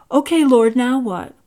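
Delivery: impatient for what comes next; eager question. A narrator's reading. Carl eagerly awaits next instruction.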